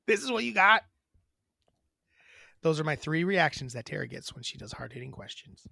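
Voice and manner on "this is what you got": incredulous, higher what the fuck; baffled, let down disbelief; disbelieving. Retold or imitated. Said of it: "there's a third reaction where it's like".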